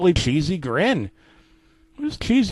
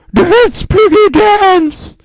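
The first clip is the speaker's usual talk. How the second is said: very high, loud, slow screech; yelled command; 8 dB louder, much slower than usual. Acted. Mister Potato yelling at Daddy Pig to dance. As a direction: scream it down at the pig, drawn out. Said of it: "Mister Potato then yelled".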